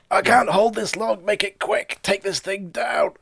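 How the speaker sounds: high, loud, slow Scottish engineer; strained Scottish shout, slow and high; Scotty from Star Trek. Acted. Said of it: "he sounded like, uh, Scotty from Star Trek".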